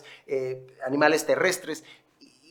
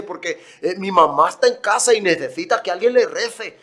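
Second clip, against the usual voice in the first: put-on Spanish accent; 3 semitones higher, 5 dB louder than usual. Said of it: "el acento característico español".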